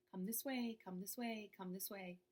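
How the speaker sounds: soft, calm, fading to a hush; gentle inner voice. Shown as a demonstration. Inner voice gently guiding the listener, soft and calm. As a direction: coax like a gentle inner guide. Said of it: "does not yell and scream".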